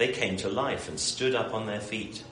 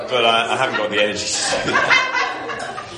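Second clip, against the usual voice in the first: laughing while talking; 4 semitones higher, 10 dB louder than usual. Real, not acted. Laughing through admitting tiredness.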